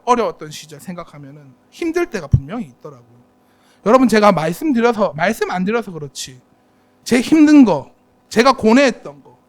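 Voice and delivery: usual speaking voice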